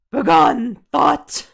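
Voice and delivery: loud, high banishing cry; shouted dramatic banishing; commanding shout. Acted. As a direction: cast the thought out with force. Casting out the enemy.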